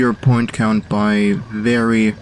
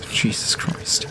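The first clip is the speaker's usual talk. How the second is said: whispered curse; 6 dB quieter, slower than usual. Real, not acted. Near miss in the game.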